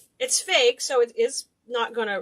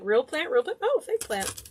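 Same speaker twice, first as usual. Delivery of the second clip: lightly acted onlooker, pitched up; mock passer-by; 3 semitones higher than usual. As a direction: play-act someone checking out the plants. Mimics people inspecting fake garden plants.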